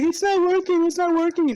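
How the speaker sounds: high-pitched self-mocking complaint; mock whine. Airhead podcaster complaining about her headset, mock whiny. Imitating own frustrated complaint.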